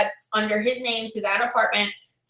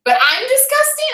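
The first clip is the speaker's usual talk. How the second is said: high incredulous retort; indignant disbelief; 12 semitones higher than usual. Retold or imitated. Tenant caps her comeback to the neighbour, high and incredulous. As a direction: pitch it up in offended disbelief.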